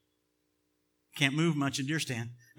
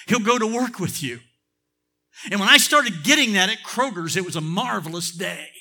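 raised, forceful pulpit delivery; emphatic preaching; 5 semitones higher, 7 dB louder than usual. Real, not acted. Preacher warning church about the thief, emphatic and building. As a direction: punch the key words with preacher's fervor.

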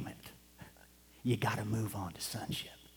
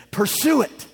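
much higher and louder, preaching; fervent shout; 11 semitones higher, 10 dB louder than usual. Real, not acted. Preacher exhorting the congregation at full force. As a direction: ring it out to the rafters.

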